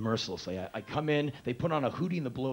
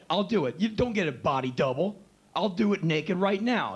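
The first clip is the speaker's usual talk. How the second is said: macho bravado; 5 semitones higher, 4 dB louder than usual. Retold or imitated. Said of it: "my macho bullshit".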